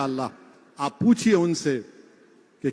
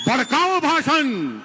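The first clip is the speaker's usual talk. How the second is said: mock news headline; 6 semitones higher than usual. Speaker mocks how news channels label his speech, mock anchor shout. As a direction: parody a sensational TV anchor.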